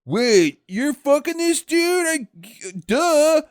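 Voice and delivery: high, loud whiny jealous voice; mock jealous pout. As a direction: sulk and storm off in a high whine. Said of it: "And he's like".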